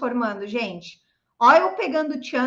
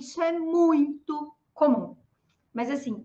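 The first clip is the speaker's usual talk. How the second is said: ordinary talk, nothing marked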